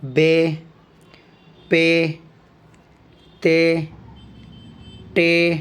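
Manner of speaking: slow, clear recital of each letter name; letter names recited. Shown as a demonstration. Teacher reciting letter names slowly for the class. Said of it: "تو چلیے پڑھتے ہیں".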